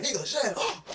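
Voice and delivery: high-pitched mock exasperation; exasperated opponent. Fed-up receiver answering the yapping defender, exasperated. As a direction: squeal it like someone pestered past their limit.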